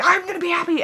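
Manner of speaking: high, loud shouted vow; mock scream. Shown as a demonstration. Vlogger demonstrating how to scream at herself. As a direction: yell it out to hype yourself up. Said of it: "start screaming and be like".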